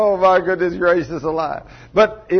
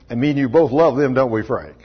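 ordinary talk, nothing marked

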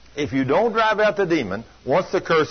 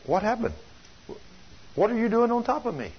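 higher, softer, bewildered waking question; dazed confusion; 7 semitones higher, 6 dB quieter than usual. Retold or imitated. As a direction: ask as if just coming to, lost and soft. Wife waking from demonic trance.

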